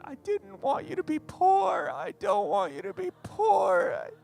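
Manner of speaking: high, slow, tearful mother's plea; crying mom. Retold or imitated. Mom pleads with son not to become a pastor, high tearful voice. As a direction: imitate a mother begging through tears. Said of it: "my mom starts going".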